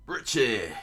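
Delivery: loud anguished call of the name; loud cry for the fallen lion; screamed name. Acted. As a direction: scream your pet's name. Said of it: "Moji screamed down".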